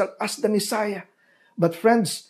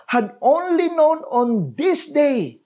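raised, slow, grieving plea; slow lament; 8 semitones higher, slower than usual. Acted. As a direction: stretch each word like a sigh of regret. Jesus laments over Jerusalem.

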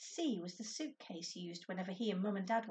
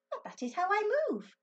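puzzled; 12 semitones higher, 6 dB louder than usual. Acted.

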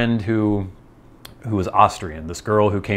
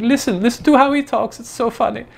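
very high mock voice of an excited friend; amused female friend. Friend insisting he listen to the funny accent, mock excited. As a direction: mimic her in a squeaky-high, insistent voice. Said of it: "she just starts laughing hysterically".

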